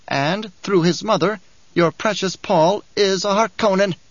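a sly little dwarf preaching the uses of war; sly dwarf Bijaz. Acted. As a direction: lecture like a gleeful little schemer. Bijaz taunts Hayt.

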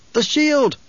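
high alarmed shout. A narrator's reading. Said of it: "one of the Sardaukar officers shouted".

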